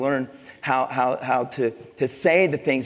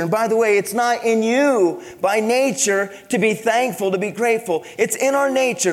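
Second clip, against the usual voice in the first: raised, emphatic preaching voice; 9 semitones higher, 4 dB louder than usual. Acted. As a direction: drive the point home to the congregation. Preaching at full force.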